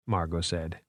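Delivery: ordinary delivery, nothing marked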